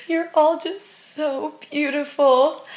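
overcome, gushing through tears; tearful laughing cry. Retold or imitated. Telling the group they're beautiful, tearful laugh-cry. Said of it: "I start crying".

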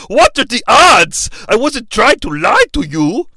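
amazed, in a put-on Austrian accent; put-on Waltz accent. Iroh tells Aang what the odds were, mimicked Austrian accent.

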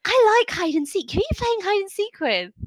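put-on high, eager little girl; a child wanting to join the game; orc child voice. Orc child asking the adventurers who they play with, high and eager. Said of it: "She says".

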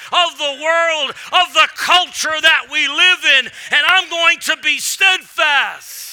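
loud, high fired-up sermon voice; full-force preaching. Acted.

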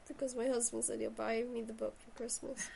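normal talking voice

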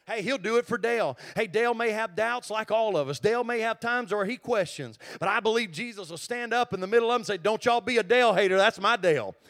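loud, driving sermon climax; full-force preaching. Acted. Pastor promising the church Jesus defends them, shouting. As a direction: build to a shout over the congregation.

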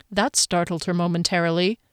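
ordinary delivery, nothing marked

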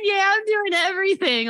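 high, proud mock agreement. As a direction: laugh it off, proud of it. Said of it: "I'm like".

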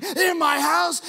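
high, loud, slow furious shout; shouting mobster. Retold or imitated. Preacher acts the mobster's rage for junior highs, hammed-up shout. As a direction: bellow it like the enraged Godfather. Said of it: "kids crying".

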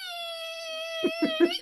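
very high, thin sustained squeal; imitated high-pitched noise. Showing the noise at the office, high-pitched squeal. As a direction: hold one high piercing tone softly. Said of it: "But I hear this".

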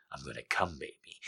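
broken, breathless whisper. Acted. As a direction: pant the words, voice breaking. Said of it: "he pants in a broken, breathless voice".

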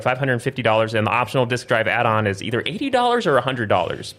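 ordinary talk, nothing marked

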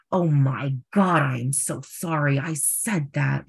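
slow, drawn-out acted apology; overdramatic apology. Friend apologizing after class, slow and overdramatic. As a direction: make a whole scene of being sorry. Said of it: "like, a whole mini scene".

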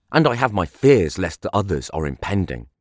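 usual speaking voice

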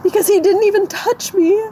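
real high-pitched, tearful voice; crying. Real, not acted. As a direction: choke it out through sobs. Crying as she explains herself.

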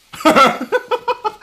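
shrill burst of laughter, way up high; high squealing laugh. Real, not acted. Laughs at own voice trick.

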